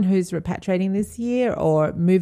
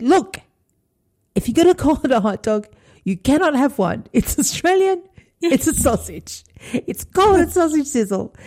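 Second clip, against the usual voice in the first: retold exasperated outburst; 7 semitones higher than usual. Mum at school sausage sizzle scolding parents, higher exasperated rant. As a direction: scold the parents like you've lost it, half laughing at yourself. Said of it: "totally lost it myself".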